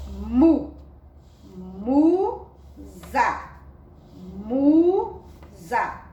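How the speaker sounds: MUSA split into MU and ZA, drawn out; syllable by syllable. Shown as a demonstration. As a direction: teach MUSA in loud, separated syllables. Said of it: "vai ficar outro som".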